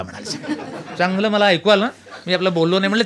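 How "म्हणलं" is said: usual speaking voice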